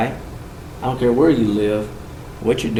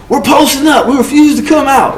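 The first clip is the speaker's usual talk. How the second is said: defiant inmate; 12 semitones higher, 4 dB louder than usual. Retold or imitated.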